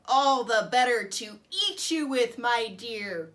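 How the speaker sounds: loud, slow menacing growl; wolf revealing himself, growling. Acted. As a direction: growl it as he lunges. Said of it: "growled the wolf".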